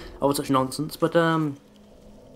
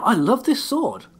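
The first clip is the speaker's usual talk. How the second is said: excited; 10 semitones higher, slower than usual. Real, not acted. Gushing over one-hit kill.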